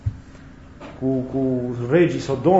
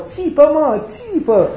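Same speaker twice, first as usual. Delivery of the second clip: high, raised-voice imitation of doctors; urging shout; 11 semitones higher, 3 dB louder, much slower than usual. Doctors telling a boy in pain to scream, mock urging shout. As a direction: urge him on in a mock shout.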